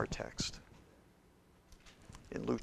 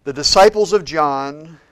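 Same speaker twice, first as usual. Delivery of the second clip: louder, higher sermon drive; preaching; 4 semitones higher, 13 dB louder than usual. Acted. Pastor telling the crowd following Jesus, fervent preaching. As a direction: push the story forward from the pulpit.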